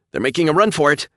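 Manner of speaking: high loud callout; urgent callout. A narrator's reading. Officer reporting to captain, urgent. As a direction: call it out urgently.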